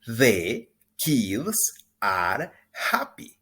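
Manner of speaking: slow, clear teacher model line; slow and loud, each word spelled out; slow model sentence. Shown as a demonstration. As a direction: say it slowly for the class to repeat. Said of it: "Repita después de mí".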